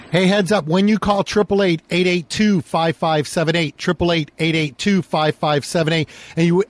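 loud, high radio ad pitch; punchy infomercial read, sell it hard. Acted. Host's ad read over music.

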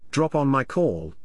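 ordinary delivery, nothing marked

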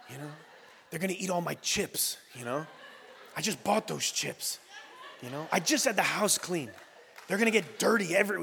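low, muttered mock complaints; grumbling. Reluctant host dreading guests in the house, grumbling. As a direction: sigh and grouse quietly to yourself. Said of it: "is a grumbling".

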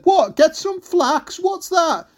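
high, drawn-out puzzled call; mishearing mum. Acted. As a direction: call back baffled from far below. Mum mishears the shouted words.

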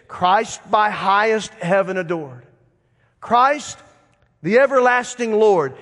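slow, measured recital of hymn lines; emphatic recitation. Shown as a demonstration. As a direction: draw out each phrase of the carol.

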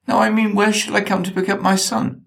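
a rattled crook backpedalling on the phone; flustered kidnapper. Acted.

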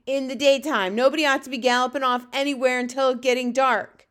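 loud, domineering giant; Fleshlumpeater, bullying. A narrator's reading. Bully giant demanding answers from the runty one, booming.

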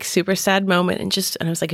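usual speaking voice

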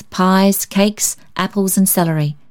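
plain reading voice

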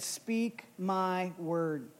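very soft, low and slow; hushed word-by-word instruction; solemn quiet command. Retold or imitated. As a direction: speak it slowly as an inner voice. Holy Spirit's quiet command in story.

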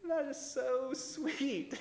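slow, high, put-on sweet voice; forced sweetness. Bride to grandmother about her old dress, slow fake sweetness. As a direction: pretend to love it while dreading it. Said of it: "and you're like".

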